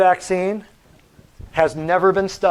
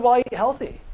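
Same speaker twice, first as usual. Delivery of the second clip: quoting the patient; 7 semitones higher than usual. Retold or imitated. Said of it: "He said".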